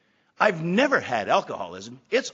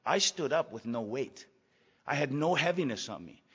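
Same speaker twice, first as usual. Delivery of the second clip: usual speaking voice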